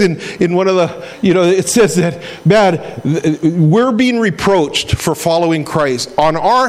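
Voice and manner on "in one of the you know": ordinary talk, nothing marked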